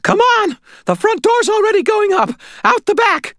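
very high, loud squeaky yelling; urgent old lady. A narrator's reading. Old lady with keys to Harry, urgent squeaky shout. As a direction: rush him out in a squeaky old-woman shout.

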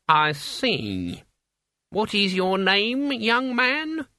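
put-on high, crackly old woman; old witch voice. A narrator's reading. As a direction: play an elderly witch, brisk. Witch voice in narration.

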